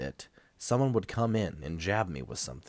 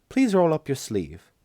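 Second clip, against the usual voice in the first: voiced nurse; 8 semitones higher, 6 dB louder than usual. A narrator's reading.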